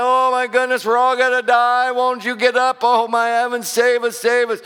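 high, loud mock panic, crying to be saved; panicked disciples. Disciples in the storm begging Jesus, loud mock panic. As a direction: play the frightened men in the storm. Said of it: "they're like".

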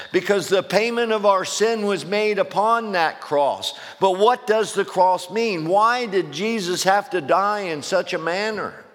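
high-pitched, driving pulpit oratory; impassioned preaching. Acted. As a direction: press each question on the congregation, pitch climbing high. Preaches with rising force.

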